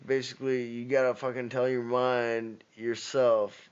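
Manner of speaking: ordinary talk, nothing marked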